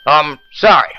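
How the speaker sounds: ordinary talk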